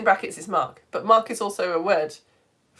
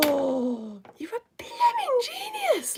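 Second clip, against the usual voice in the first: loud gasp, then high breathy whispered praise; floored by the line; awed gasp and whisper; 12 semitones higher, 6 dB louder, much slower than usual. Real, not acted. Host stunned by Edmund's poem, gasp then whisper.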